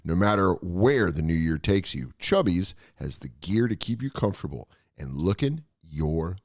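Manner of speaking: laid-back sponsor read. Acted. Host reading the Chubbies sponsor spot, ad read. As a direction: sell the shorts casually, friendly pitch.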